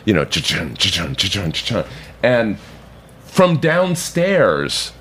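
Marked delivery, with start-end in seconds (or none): 0.2-1.8 rhythmic cha-chun of a rattled door; mouth-made door rattle; rattling door noise. Retold or imitated. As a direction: imitate a door shaking in its frame. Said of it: "you can hear it kind of go".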